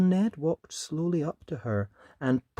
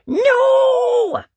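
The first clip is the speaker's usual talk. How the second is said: much higher, loud defiant shout; Ned defying his mum for the first time; cross no; 13 dB louder than usual. Acted. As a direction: fling it out, hot and cross. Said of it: "all hot inside and cross".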